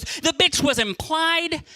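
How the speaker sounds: hammed-up pointed retort; slow retort. Girlfriend retorting to her partner, slow and pointed.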